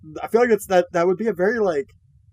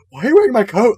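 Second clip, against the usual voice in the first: freaked out; 7 semitones higher, 8 dB louder, faster than usual. Retold or imitated. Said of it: "kind of freaked out by it".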